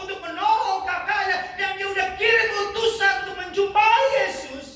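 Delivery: rising sermon fervor; full-force preaching. Acted. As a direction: preach it out to the congregation. Preaching build-up on Jesus' power.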